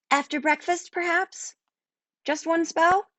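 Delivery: high, pleading ask; girl coaxing her new familiar. A narrator's reading. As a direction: wheedle gently for one spell. Merlinda coaxing.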